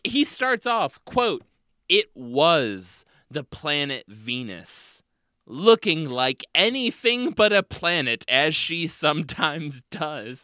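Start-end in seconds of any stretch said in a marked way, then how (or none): 1.9-10.4 sultry reading. Shown as a demonstration. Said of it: "sounds like the beginning of a Harlequin romance novel".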